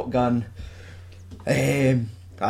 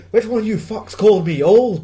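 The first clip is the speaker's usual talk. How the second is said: raised, high-pitched outburst; mock furious demand; 8 semitones higher, 5 dB louder than usual. Gunman storms in on the people who insulted him, mock furious. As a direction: burst in demanding answers, hammed up. Gunman's first line on walking in.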